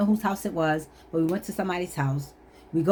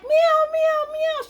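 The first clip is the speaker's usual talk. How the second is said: very high, insistent cat meows; high-pitched mock meowing; mother cat meowing; 3 dB louder, much slower than usual. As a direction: imitate an upset cat. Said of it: "the mother cat of that kitten was like".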